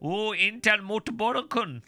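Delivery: high, hammed-up German accent; mock German accent. Misreading German page as Dutch, hammy mock-German accent. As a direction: put on a big slow German accent, relishing it. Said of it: "That was German".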